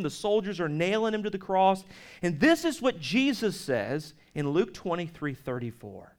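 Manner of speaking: usual speaking voice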